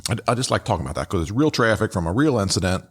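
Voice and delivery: ordinary delivery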